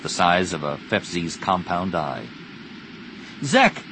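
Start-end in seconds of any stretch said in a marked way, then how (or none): 3.4-3.8 high-pitched yelled call; yelling. A narrator's reading. Pilot calling crewmate through targeting mask, yelling. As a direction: yell to get the pilot's attention. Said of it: "she yelled into the targeting mask".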